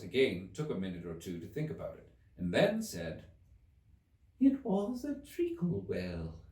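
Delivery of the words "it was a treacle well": high, slow drowsy squeak; sleepy Dormouse. A narrator's reading. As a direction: answer half asleep.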